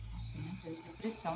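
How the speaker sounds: quiet dismayed murmur; a hushed, stunned Nossa, meu Deus. Retold or imitated. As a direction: let it drop to a shocked near-whisper. Retold reaction to diagnosis.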